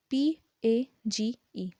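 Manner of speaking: पी ए जी ई, each letter slow and separate; slow spelling. Shown as a demonstration. Teacher spelling page slowly for students. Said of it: "हम page को कैसे लिखते हैं?".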